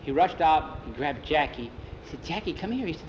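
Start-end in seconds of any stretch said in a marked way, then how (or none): 2.2-3.1 keyed-up, high-pitched beckoning; urgent summons. A narrator's reading. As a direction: urge a friend over. Urgently fetching a friend.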